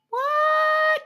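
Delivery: high, loud disbelieving shout; sky-high shout of disbelief at the spirits; shocked outcry. Retold or imitated. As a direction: yelp it in total disbelief. Shocked reply to divine message.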